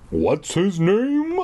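slow choked question. Acted. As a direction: force it out through a tight throat. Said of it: "I choked out".

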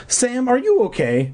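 very high, concerned question; taken aback and checking on a child; startled mother. A narrator's reading. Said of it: "a startled expression on her face".

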